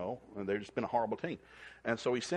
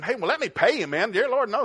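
high, loud eager offer to pay; eager thanks; 11 semitones higher, 9 dB louder than usual. Retold or imitated. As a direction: insist on paying, amazed. Grateful coach offers payment.

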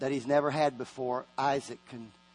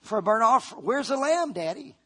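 Isaac asking; 7 semitones higher than usual. Acted. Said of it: "He says".

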